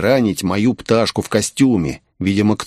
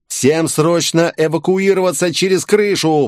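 higher, urgent command, mildly raised; shouted evacuation order; 7 semitones higher than usual. A narrator's reading. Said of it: "прокричал я". (срочный приказ криком)

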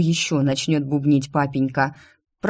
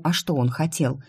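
usual speaking voice

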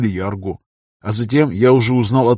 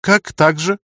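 higher-pitched, pointed challenge; mildly raised voice, demanding; loud question; 7 semitones higher, 3 dB louder, slower than usual. Acted. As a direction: cut in with a sharp, high question. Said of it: "громко спросила Лема".

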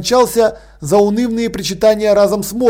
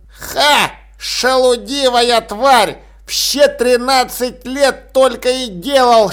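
put-on ranting old man; 4 semitones higher, slower than usual. A narrator's reading. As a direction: spit scorn at the lazy cat. Old man rants about cat.

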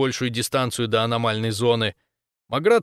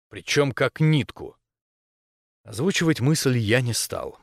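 plain reading voice

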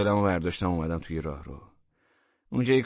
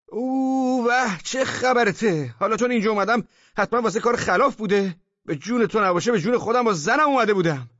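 very high, loud yelling; yelling in protest; 13 semitones higher, 6 dB louder than usual. A narrator's reading. Man hit with a handbag, yelling his excuses. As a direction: yelp and protest under the blows. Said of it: "فریاد زد و گفت".

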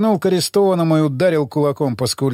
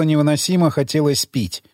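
ordinary delivery

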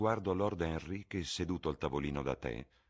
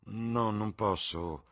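slow, drawn-out reluctant no; slow refusal; 6 semitones higher, much slower than usual. Acted. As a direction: hold back, unwilling. Basil declining, slow. (rifiuto lento e riluttante)

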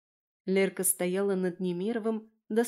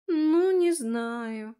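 very slow, higher, drawn-out doubt; doubtful mumble; 5 semitones higher, 4 dB louder, much slower than usual. A narrator's reading. Friend doubts all will be fine while smoking, drawling. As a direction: stretch it out doubtfully. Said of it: "пробормотала подруга".

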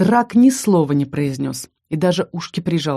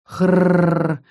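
long rasping monster groan. Acted. Bloated monster Arakarna groans lying on her back. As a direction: drag out a heavy rolling growl-moan. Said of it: "ещё тяжелее простонала тварь".

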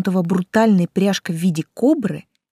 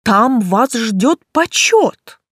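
slow, louder, higher proclamation; grand enticement; 4 semitones higher, 5 dB louder, much slower than usual. A narrator's reading. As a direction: dangle the prize grandly before her. Count tempts her with rewards. (торжественно, с расстановкой, заманивая)

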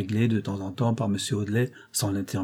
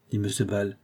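ordinary delivery

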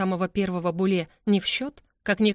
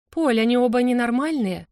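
loud, high-pitched exasperated despair; 5 semitones higher, 6 dB louder than usual. A narrator's reading. Mother complains to her husband, in loud despair. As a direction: cry out in despair, voice raised. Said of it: "с отчаянием в голосе".

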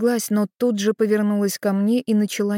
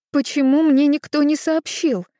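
raised, higher, louder question; louder than allowed; 8 semitones higher, 6 dB louder than usual. A narrator's reading. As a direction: blurt it out too loud. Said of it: "Чуть громче, чем было можно". (слишком громко)

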